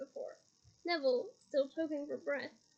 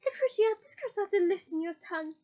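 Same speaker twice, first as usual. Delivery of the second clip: usual speaking voice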